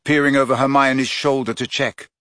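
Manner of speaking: usual speaking voice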